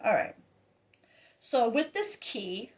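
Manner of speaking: usual speaking voice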